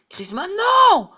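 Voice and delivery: loud, high, drawn-out shout; frustrated shout. Real, not acted. Gamer watching his striker miss, loud dismayed shout. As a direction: yell the name out in dismay.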